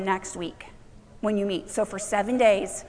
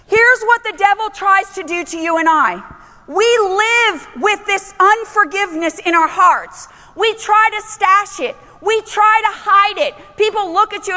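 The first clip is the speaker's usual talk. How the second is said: full-force preaching; 8 semitones higher, 8 dB louder than usual. Acted. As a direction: preach it out to the whole room.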